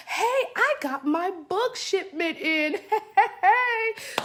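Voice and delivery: high-pitched, gleeful little celebration with a laugh; excited thought. Retold or imitated. Author remembering her book shipment arrived, excited. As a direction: replay a delighted realization, rising to a high "hey!".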